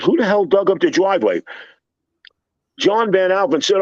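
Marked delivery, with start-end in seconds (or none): 0.0-1.4 angry. Retold or imitated. Angry boss yells at worker.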